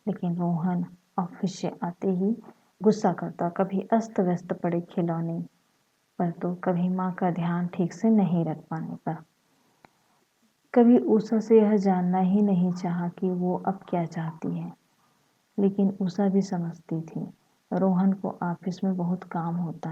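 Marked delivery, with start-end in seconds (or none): none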